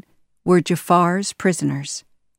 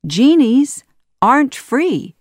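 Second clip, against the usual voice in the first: triumphant reveal; 7 semitones higher, 6 dB louder than usual. Acted. Aladdin springs the trap.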